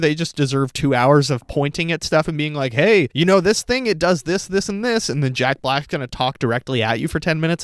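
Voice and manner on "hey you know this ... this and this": higher-pitched mocking show-and-tell; mock eager explainer. Critic parodies a movie pointing at stuff, mock eager. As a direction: imitate someone pointing things out.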